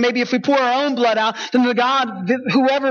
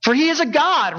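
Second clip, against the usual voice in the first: mocking taunt; 5 semitones higher than usual. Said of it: "he began mocking them".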